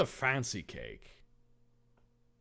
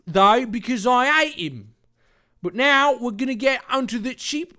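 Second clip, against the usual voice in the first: much higher, loud mock Cockney villain; 12 semitones higher, 9 dB louder than usual. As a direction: boast the evil plan in Cockney. Jack keeps Cockney voice.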